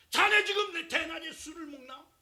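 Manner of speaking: high, loud accusing rebuke; scolding priest. Acted. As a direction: scold her like a shocked old priest. Eli scolding Hannah, acted.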